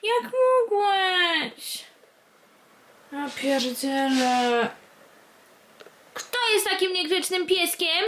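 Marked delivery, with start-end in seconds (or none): 0.0-1.8 very slow, high whiny reproach; mock wail. Real, not acted. 6.1-8.0 higher mock-scolding coo. Real, not acted. Scolding dog in baby talk.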